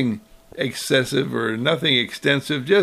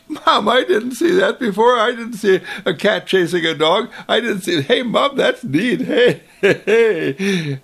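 put-on high, louder, laughing kid's glee; delighted child; 7 semitones higher, 4 dB louder than usual. Child thrilled to see the cat chase the dog, laughing. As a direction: squeal with delight at the discovery.

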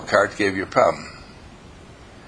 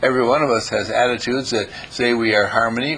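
ordinary talk, nothing marked

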